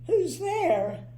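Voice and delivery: high, mournful squeak; sad squeaky voice. Acted. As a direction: squeak it out sadly from behind the door. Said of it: "A sad, squeaky sort of voice".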